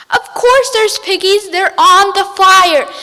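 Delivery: loud, high insistent witch voice; angry witch. Acted. Witch insisting to the ducks, loud and high.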